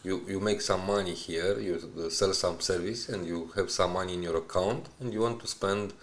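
normal talking voice

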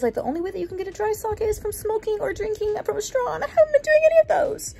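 confused. Real, not acted. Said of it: "I'm really confused".

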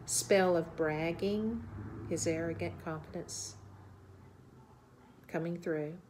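slow, grave narration with deliberate pauses; documentary narration. Acted. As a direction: lay out the case, measured and serious. Narrates findings to camera.

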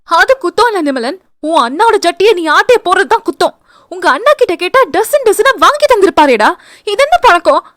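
high, sharp scolding that builds; angry snap. Acted. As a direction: lash out at him in accusation. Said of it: "என்று சீறினாள்".

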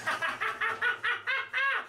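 high, strained laugh in the cold. Real, not acted. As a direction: let out a breathless laugh. Laugh in ice bath.

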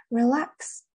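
"relax" stressed on its last syllable; stress on second syllable. Shown as a demonstration. Teacher showing students the base word, stress on the end of "relax". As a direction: say "relax" with the weight on -lax. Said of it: "这个重音是往后移了".

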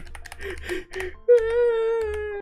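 a high, squeaky burst of laughter; giggling fit; high squealing laugh. Real, not acted. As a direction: squeal with laughter at your own joke. Laughing at own joke.

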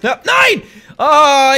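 sudden high loud yell of dismay; frustrated shout. Real, not acted. Gamer too slow to press B, frustrated shout.

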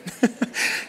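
short airy laugh; soft unvoiced chuckle; a quick breath of a laugh; breathy laugh. Real, not acted. Laughs at reprimanding Jesus.

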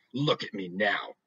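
slow, menacing mother's command; slow, cold threat that means business; death voice. Acted. Mother giving her final warning, slow and menacing. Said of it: "the voice that means business".

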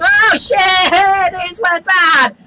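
high, loud alarm; excited game panic; panicked yell. Real, not acted. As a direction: shout as it all backfires. Prank backfires in the game.

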